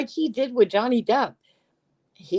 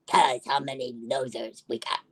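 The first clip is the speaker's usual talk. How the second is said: low, mocking put-on voice; mock taunting voice; 7 semitones lower, 4 dB quieter than usual. Mocking a rival streamer who gloats.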